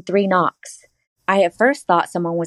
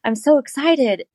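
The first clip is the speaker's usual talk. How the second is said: higher, gushing mock-excited greeting; bubbly retold hello; retold excitement; 7 semitones higher than usual. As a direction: play up the thrill of meeting him. Retelling excited first meeting.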